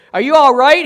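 called out loud and high, anxious. Acted. Doctor calls out anxiously to the young man in the road. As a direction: shout it out to the stranger on the road. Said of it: "he called anxiously".

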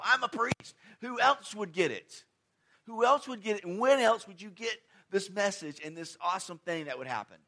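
high, loud, smug certainty; proud Zechariah. Acted. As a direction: play the sure priest full of himself. Acting out Zechariah's proud thinking.

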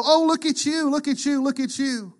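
high, gushing mock praise; mock admiration. Onlookers praising a showy believer, mock gushing. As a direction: fawn over them like onlookers showing off. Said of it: "It's not so that people go".

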